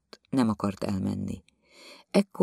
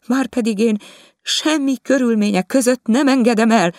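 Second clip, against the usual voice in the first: higher and louder, stubborn refusal; stubborn wife; 13 semitones higher, 7 dB louder than usual. Acted. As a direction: dig your heels in. (makacs feleség, magasabban és hangosabban)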